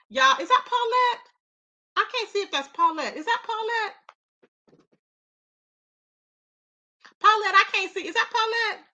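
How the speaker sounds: delighted recognition; excited calling out. Real, not acted. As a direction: hail a friend in the chat. Excitedly spotting a viewer.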